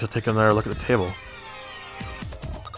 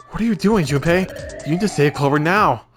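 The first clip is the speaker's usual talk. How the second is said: higher, louder frantic urging; urgent woman voice; 5 semitones higher, 3 dB louder than usual. Acted. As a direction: push him to act now. Voicing Lotus urging Junpei.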